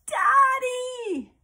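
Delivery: high loud shout for dad; yelling. Acted. Girl trapped in a box calling her dad, long high shout. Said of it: "Julie yelled".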